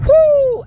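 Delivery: high, loud whew shaking it off; loud exhale of relief. Real, not acted. Storyteller shakes off the memory with a loud, high whew. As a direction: blow the tension out. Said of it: "I hate to go back to the moments".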